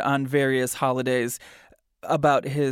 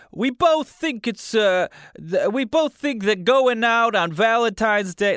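put-on hesitant New Jersey accent; 7 semitones higher, 4 dB louder than usual. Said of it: "listen, I don't do accents".

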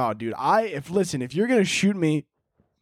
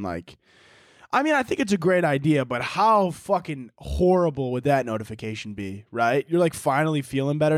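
usual speaking voice

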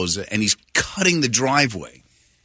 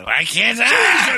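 slow, high mock shout; mock yell; 9 semitones higher, 3 dB louder, much slower than usual. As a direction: bellow it like an angry dad. Retelling father yelling.

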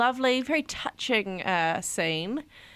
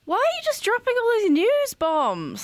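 mock outrage; 10 semitones higher than usual. Real, not acted.

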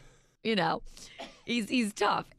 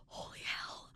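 hushed whisper, barely any voice; an awestruck whisper; 15 dB quieter, slower than usual. Retold or imitated. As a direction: breathe it out in awe. Awed reaction, retold to co-host.

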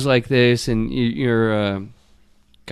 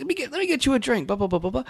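high, soft, quick friendly patter; light, high-pitched mock chumminess; chummy dad imitation; 11 semitones higher, 7 dB quieter, much faster than usual. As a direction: imitate an eager host rattling off niceties. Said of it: "being, like, really cool with me".

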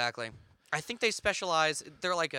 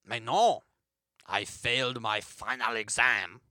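put-on low French-accented mime; 5 semitones lower than usual. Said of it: "my French accent isn't that good".

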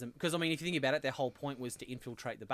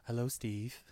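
HAL computer voice; 2 semitones lower, much slower than usual. Retold or imitated. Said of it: "what's that one from, um, uh, two thousand Space Odyssey".

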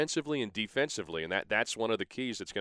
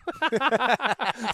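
loud, high laugh breaking out; high burst of laughter; 5 dB louder than usual. Real, not acted. Guest laughing at his own joke, a loud high laugh. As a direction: crack up at your own punchline.

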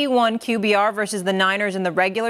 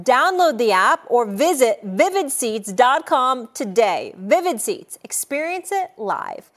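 ad read. Acted. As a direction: sell it like a radio spot. Host reads sponsor ad.

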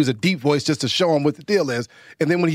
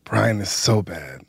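put-on low, slow whiny kid complaint; mock Brian voice; 9 semitones lower, much slower than usual. Dad imitating his son, low and slow. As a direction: mimic the son grumbling. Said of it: "let's do your son Bry's voice".

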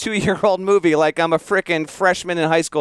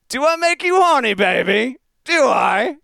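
high-pitched, hammed-up British accent; 9 semitones higher than usual. Podcaster blurting out a movie line while on hold, in a put-on British accent. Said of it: "what's something common that's a British accent".

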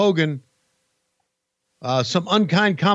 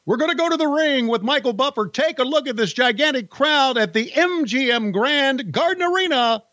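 higher, booming announcer voice; wrestling promo; 6 semitones higher than usual. Acted. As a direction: call it like a ring announcer. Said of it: "get that old wrestling voice ready again".

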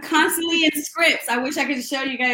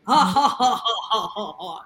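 imitated laugh in a string of ha-has; 5 semitones lower than usual. Showing how he always laughed with them, imitated laughing. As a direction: put on someone else's hearty laugh, fading lower. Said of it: "he was always laughing, like".

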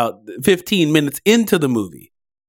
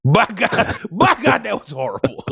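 mock echo; 7 semitones higher than usual. Echoing the line higher.

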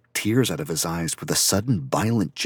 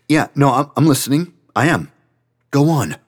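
higher and louder, hurried reassurance; nervous, eager, raised voice; flustered; 8 semitones higher, 8 dB louder than usual. Acted. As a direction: scramble to show you're listening. Flustered reassurance to upset friend.

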